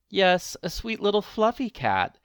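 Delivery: a sweet wish for a fluffy cat; old woman voice. A narrator's reading. Old woman longing for a cat, lightly voiced.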